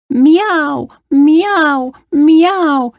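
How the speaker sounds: high meows falling at the end; screeching cat. A narrator's reading. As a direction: imitate an upset cat. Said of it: "screeched the cat".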